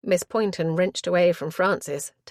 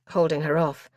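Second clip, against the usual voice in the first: plain reading voice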